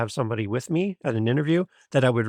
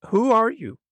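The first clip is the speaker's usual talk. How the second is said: higher, louder amazed "Who are you?"; amazed repeat; 10 semitones higher, 4 dB louder than usual. Retold or imitated. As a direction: hit "are" high and loud in wonder. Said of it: "And she said".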